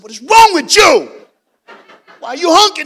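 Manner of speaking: high, shouted comic exasperation; hammed-up indignant shout; outraged scolding. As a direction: yell at the driver in disbelief. Retells scolding the honking driver.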